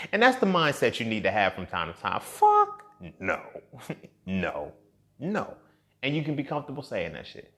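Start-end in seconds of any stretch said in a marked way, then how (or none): 2.2-5.6 emphatic refusal. Shown as a demonstration. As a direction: exaggerate the no with a big pitch leap. Models the refusal mindset, exaggerated.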